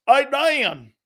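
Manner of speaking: hammed-up Southern accent, Namath style; Joe Namath Southern accent. Host imitating Joe Namath's Southern accent. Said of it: "how Joe Namath got a Southern accent".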